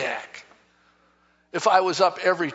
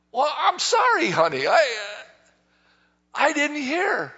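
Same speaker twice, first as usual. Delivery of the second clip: higher, hammed-up stammering apology; defensive husband; 6 semitones higher than usual. Husband apologizing to his wife, mock-defensive. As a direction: play a flustered husband excusing himself.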